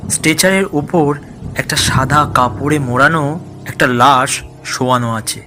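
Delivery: ordinary delivery, nothing marked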